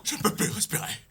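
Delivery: whispered, gasping for air; breathless whisper. Acted. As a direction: choke it out in a whisper. Dubbing panicked lion.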